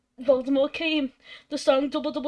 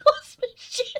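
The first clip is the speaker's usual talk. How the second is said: high, squeaky through laughter; real giggling fit; laughing. Real, not acted. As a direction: gasp it out mid-laugh. She can't stop laughing.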